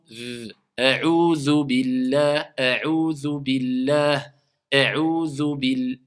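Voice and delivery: a'udhu billah very slow, dh held; slow recitation. Shown as a demonstration. Teacher reciting slowly so students hear the zal. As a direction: draw out a'udhu billah, lingering on the dh. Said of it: "vous entendez le zal?".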